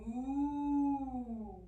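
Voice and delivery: long, rounded moo; cow moo. Shown as a demonstration. Yoga teacher showing children what cows say, long cow moo. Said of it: "do you know what cows say?".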